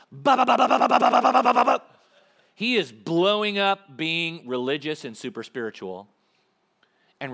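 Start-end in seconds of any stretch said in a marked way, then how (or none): 0.1-1.8 loud rapid mock gunfire, ba ba ba; spray the words like a machine gun burst; machine gun noise. Preacher imitating Jesus firing on the religious, loud gunfire sounds. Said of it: "gun selected, sixth position".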